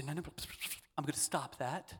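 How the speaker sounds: quiet self-correction; firm self-talk. Pretend. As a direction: calmly shut your own doubts down. Telling off your own thoughts.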